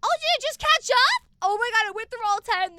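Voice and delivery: high, loud, quick burst; excited surprise. Real, not acted. Streamer noticing the gifts finally arrive, thrilled. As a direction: blurt it out, thrilled.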